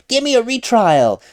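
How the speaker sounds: a loud, bare demand; a half-mocking loud demand. Shown as a demonstration. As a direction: bark it like a spoiled plaintiff. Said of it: "You can't just say".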